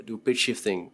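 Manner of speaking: usual speaking voice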